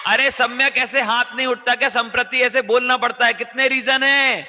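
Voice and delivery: high-pitched shouted chiding of pupils; loud prodding. Real, not acted. Teacher prodding pupils to raise hands and answer, shouting. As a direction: shout at them to speak up, stretching the end. Scolds pupils for not responding.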